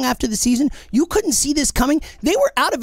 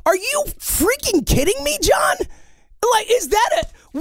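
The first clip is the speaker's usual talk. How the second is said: high-pitched, appalled challenge; incredulous outrage; 10 semitones higher than usual. Real, not acted. Host challenging John over his defence of the team, outraged. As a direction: voice climbing sharply in disbelief.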